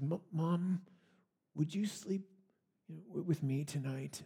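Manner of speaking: put-on scared child's whispered plea, soft and halting; timid, barely voiced little boy asking for comfort; shaky little voice. Scared boy begs mom to stay during thunderstorm, shaky whisper. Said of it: "with his shaky little voice says".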